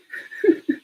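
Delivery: short, breathy chuckle; real laugh. Real, not acted. Vlogger joking to viewers about being in jail, real laugh. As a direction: laugh briefly at your own remark. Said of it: "I'm laughing".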